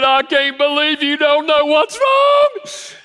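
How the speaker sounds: high, loud, slow mock wail from the wife; a hurt wife lets it out, pitched high; wife voiced crying out at her husband, high and loud; upset wife outburst. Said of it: "she's crying".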